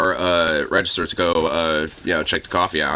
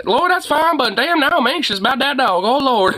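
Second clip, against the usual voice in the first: much higher, mock rattled old lady; imitating shaken lady. Old lady forgiving the dog owner, high mock jittery voice. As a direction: voice her as still jittery but forgiving. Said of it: "she was like".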